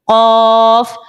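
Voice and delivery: قاف long, tongue root far back; heavy qaf. Shown as a demonstration. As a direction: draw out قاف, full and heavy. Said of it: "قاف کو موٹا پڑھنا ہے".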